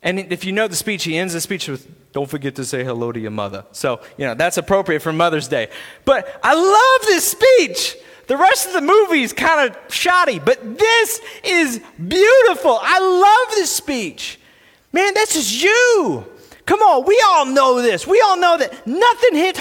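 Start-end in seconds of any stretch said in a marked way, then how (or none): 2.1-3.7 low, soft, quick mumbled impression; much lower, softer tough-guy sign-off; Sylvester Stallone. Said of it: "my best Sylvester Stallone voice". 6.1-16.3 high, drawn-out preacher's exclamations; slow, high, excited proclaiming; impassioned enthusiasm. Real, not acted. Preacher gushes about the speech.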